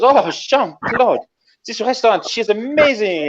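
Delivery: high-pitched French admirer, drawn-out name; swooning Frenchwoman. Acted. Woman to the fighter pilot on his car, swooning. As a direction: coo it high in a French accent. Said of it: "And she goes".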